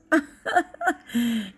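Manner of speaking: short bright burst of laughter. Real, not acted. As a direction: laugh out loud at your own remark. Laughs at own remark.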